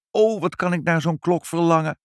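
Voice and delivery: high, fervent wishing; longing. Acted. Mother wishing for a clock like the baron's, yearning.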